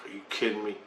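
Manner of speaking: soft, under the breath; muttered disbelief. Retold or imitated. Answering the urge to go to the river, muttered disbelief.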